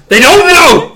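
high, loud holler; hammed-up high-pitched shout; mock protest. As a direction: yell it out in playful exasperation. Playful protest about lost context.

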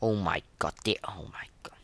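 ordinary talk

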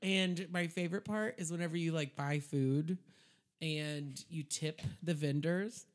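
ordinary talk

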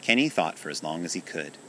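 plain reading voice

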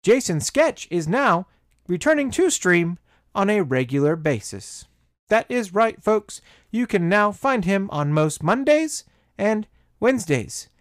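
high, loud announcer read; big, emphatic announcement with pauses; announcer promo. Acted. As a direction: punch it out like a stream promo. Recorded-style stream announcement.

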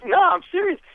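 very high, loud Leno insisting. Retold or imitated. Leno insisting to the doubting lawyer, high. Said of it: "he goes".

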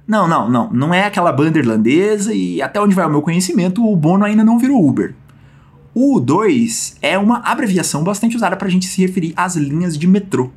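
normal talking voice

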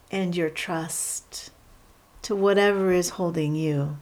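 guided meditation. Real, not acted. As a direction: guide them unhurriedly into settling, leaving space between phrases.

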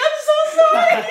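high whimpered apology, half laughing; mock bawling. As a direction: squeal it like a bawling kid. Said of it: "And I started bawling".

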